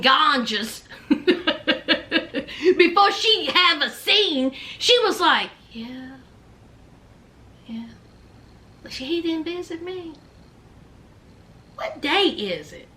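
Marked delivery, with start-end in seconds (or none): 5.7-12.9 dazed stoned mumble. Retold or imitated. Puppy answering her friends in a dazed, soft mumble. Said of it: "always got this glazed look on her face".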